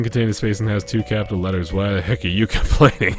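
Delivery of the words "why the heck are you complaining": ordinary talk, nothing marked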